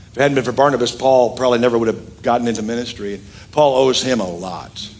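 usual speaking voice

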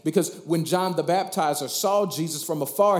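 normal talking voice

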